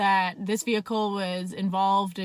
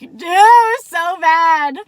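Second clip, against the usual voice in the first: laughing; 8 semitones higher, 13 dB louder, much slower than usual. Real, not acted. Laughing at her own reaction.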